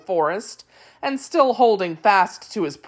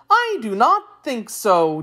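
high, swooping singsong; Wheeler creature; 5 semitones higher, 4 dB louder than usual. A narrator's reading. Wheeler doubting Dorothy's guess, high singsong voice. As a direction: disagree in a lilting creature voice.

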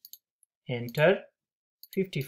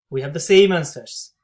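louder, delighted repeat of the result; excited repeat; 8 dB louder than usual. Real, not acted. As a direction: say it again, louder and thrilled.

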